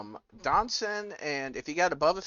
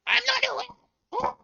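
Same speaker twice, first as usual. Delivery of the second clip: tiny shrieking rat, very high and slow; high-pitched rat scream; 4 dB louder, much slower than usual. Acted. Game master voicing a talking rat, high-pitched squeal. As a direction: squeal for help far up in pitch. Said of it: "pretend I'm doing my high-pitched voice".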